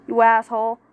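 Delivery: plain reading voice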